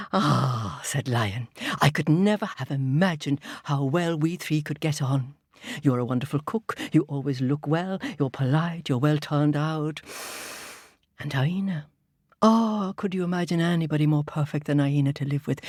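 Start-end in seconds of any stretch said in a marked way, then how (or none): 0.1-0.8 low, satisfied lion; a low contented king. Acted. 1.8-15.6 low, smug lion king. Acted. Lion praises his friends.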